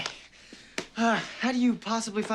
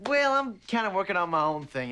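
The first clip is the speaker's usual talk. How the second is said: normal talking voice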